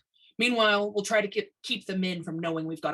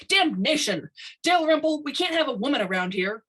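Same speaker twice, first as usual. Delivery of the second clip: loud, high exasperated outburst; louder and higher, fed-up shout; angry outburst; 8 semitones higher, 6 dB louder than usual. A narrator's reading. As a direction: explode at the inspector in frustration. Tiny exploding at inspector.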